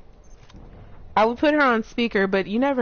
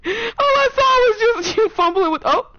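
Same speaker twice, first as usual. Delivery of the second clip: laughing; 13 semitones higher, 5 dB louder than usual. Real, not acted. Laughing at teammate's fumble.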